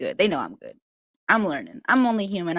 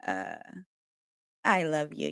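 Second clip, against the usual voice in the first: ordinary talk, nothing marked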